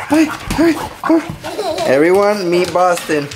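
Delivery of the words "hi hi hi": very high, squeaky little hellos; high pet greeting. Real, not acted. Greeting the dog.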